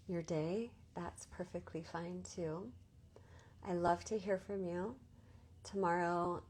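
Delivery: ordinary talk, nothing marked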